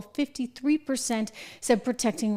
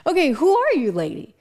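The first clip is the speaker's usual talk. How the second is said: higher, louder mock disbelief; mock bewildered; 5 semitones higher, 5 dB louder than usual. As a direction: gasp it out like you can't believe who's talking. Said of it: "It's like".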